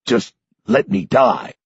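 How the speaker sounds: old man begging, a hint of despair; lightly voiced old man pleading. A narrator's reading. Old man begging the hero to let him die. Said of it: "Sanderson says to me accusingly".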